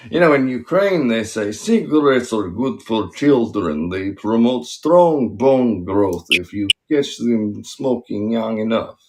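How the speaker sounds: put-on Russian accent, firm delivery. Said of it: "That's a good Russian accent".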